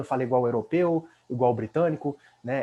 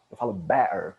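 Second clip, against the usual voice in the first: better in Cockney with a glottal stop for the T; Cockney accent; 6 semitones lower than usual. Shown as a demonstration. Teacher showing Cockney better, T dropped.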